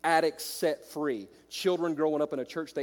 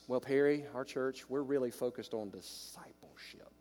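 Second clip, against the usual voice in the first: mock pastor, dropping to a hush; mock smug pastor; 3 semitones lower, 7 dB quieter than usual. Preacher mocking a pastor's excuse, hushed and smug. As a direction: mimic a self-satisfied pastor, trailing into a whisper. Mimicking a pastor who avoids evangelism.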